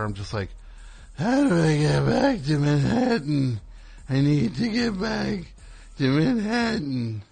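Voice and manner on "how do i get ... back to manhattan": higher, slow desperate plea; sweaty monster plea. Retold or imitated. Sweaty comic begs two girls for directions, desperate. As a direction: beg like a drenched lost monster. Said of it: "like this monster".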